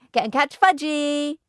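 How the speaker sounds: put-on high, singsong toddler taunt; hollering. A narrator's reading. Fudge running off from babysitters, hollered taunt. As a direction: holler it high, stretching the words. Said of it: "he hollered".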